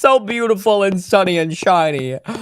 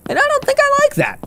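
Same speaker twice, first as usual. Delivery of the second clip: much higher, fast squeaky voice; faster than usual. Pretend. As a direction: squeak it out quickly. Repeats it in a squeaky voice.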